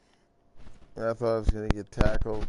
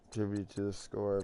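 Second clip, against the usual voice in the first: ordinary talk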